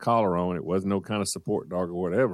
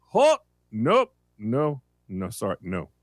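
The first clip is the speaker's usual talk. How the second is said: clipped no-nonsense refusal; stern German door lady; 3 semitones higher, 3 dB louder than usual. Retold or imitated. Costco door checker stopping a dog, stern loud refusal. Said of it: "she has a German accent, and she is a no-nonsense woman".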